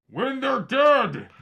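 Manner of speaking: high, very loud, drawn-out yell. Acted. Defender yells at you over the pancakes, yelling. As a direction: bellow it slowly at the top of your voice. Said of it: "like, yells at you".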